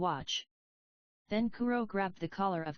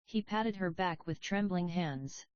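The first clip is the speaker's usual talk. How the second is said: plain reading voice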